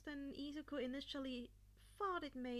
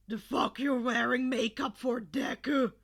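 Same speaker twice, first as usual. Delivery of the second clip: loud, harsh shouted question; angry demand; 16 dB louder than usual. Acted. Kacchan confronts Deku about the makeup, loud and angry. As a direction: snarl the question at him. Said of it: "Kacchan questioned".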